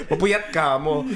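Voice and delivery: giggles running into the words; laugh, then repeat the joke. Real, not acted. Repeating the school pun to friends, laughing.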